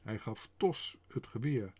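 usual speaking voice

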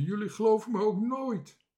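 high, whining protest; indignant cry. A narrator's reading. Bange Toontje to disbelieving shipmates, crying out indignantly. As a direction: protest high, as the one never believed. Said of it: "riep Bange Toontje".

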